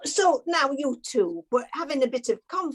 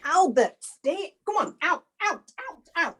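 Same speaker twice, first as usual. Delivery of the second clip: higher, urgent calls to the pet; calling the dog; 6 semitones higher than usual. Real, not acted. Owner ordering her dog Albert out, higher urgent calls. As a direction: shoo the pet out of the room.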